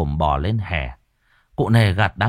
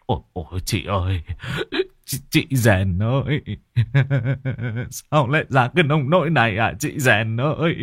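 mourning lament; 3 semitones higher than usual. Acted. Neighbor lamenting the dead woman.

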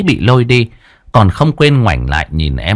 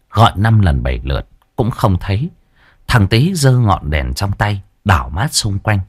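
usual speaking voice

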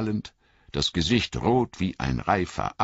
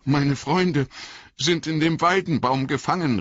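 high, urgent, breathless plea, fast at the end; breathless cry; 12 semitones higher, 3 dB louder than usual. Acted. Frodo telling Tom his friends are trapped, breathless high cry. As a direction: gasp it out, frightened and out of breath. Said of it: "rief Frodo atemlos".

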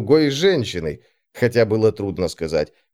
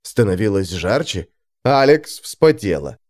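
plain reading voice

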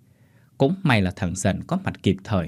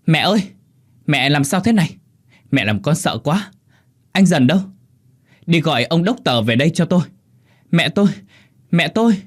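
frantic daughter, high and loud; loud cries; 7 semitones higher, 5 dB louder than usual. A narrator's reading. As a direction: shout in panic for mother and help. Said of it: "Cô la lớn". (la lớn hoảng hốt)

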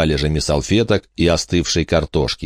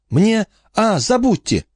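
much higher voice, breaking off; flustered boy. Acted. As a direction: stop himself mid-word, then brush it off. Horace backs off.

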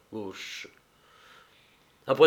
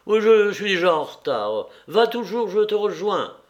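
raised voice, high and loud; calling out; 7 semitones higher, 6 dB louder than usual. A narrator's reading. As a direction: call out to a friend leaving ahead. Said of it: "cria-t-il à son ami". (crier à son ami, voix haute et forte)

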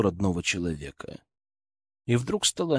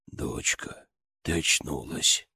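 low, soft, slow drawl of relief; quiet drawn-out voice; 8 semitones lower, 7 dB quieter, slower than usual. Acted. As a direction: stretch the words out, barely above a breath. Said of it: "протянул он тихим, не похожим на собственный голосом".

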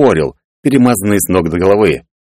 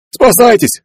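high-pitched cry of alarm; panicked shout; 4 dB louder, slower than usual. A narrator's reading. Sailor running past warns passengers, panicked shout. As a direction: shout a warning on the run. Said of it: "крикнул пробегавший мимо матрос".

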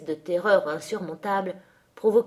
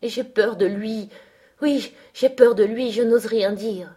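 higher, louder fearful plea; anxious, raised-pitch admission; frightened confession; 3 semitones higher, 5 dB louder than usual. A narrator's reading. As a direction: confess it as if scared to speak. Frightened Linton confessing his fear.

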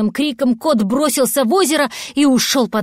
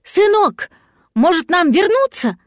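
high anxious-to-turn-back call; 7 semitones higher than usual. A narrator's reading. Cat calling his son to turn back, high call. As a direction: call after your son, pitched up. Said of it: "крикнул кот".

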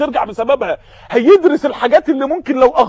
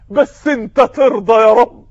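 usual speaking voice

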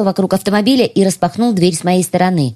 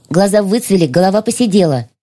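usual speaking voice

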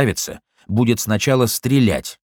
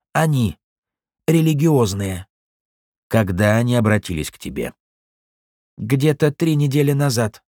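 under torture; 4 semitones higher than usual. Acted. Broken prisoner answering questions.

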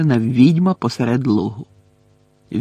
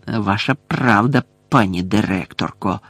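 low, hissing voice of a servile caretaker; hissed reply; 5 semitones lower than usual. A narrator's reading. Filch agrees with the headmistress, hissing low. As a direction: hiss it low. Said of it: "прошипів Філч".

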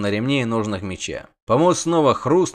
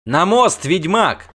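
loud, high urgent order; shouted order; 7 semitones higher, 5 dB louder, slower than usual. A narrator's reading. Sorceress orders the witcher across under rockfall, shouted order. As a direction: shout a command over the rockfall.

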